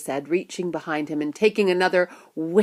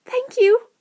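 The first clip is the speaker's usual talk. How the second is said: put-on very high, louder boy's voice; grateful child; 5 dB louder than usual. A narrator's reading. Grateful boy answers shopkeeper.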